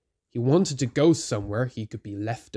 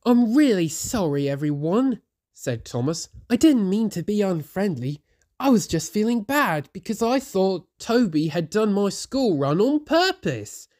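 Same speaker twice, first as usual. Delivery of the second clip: higher, earnest apology; apologizing; 7 semitones higher than usual. A narrator's reading. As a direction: say sorry to all. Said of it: "he apologized to everyone".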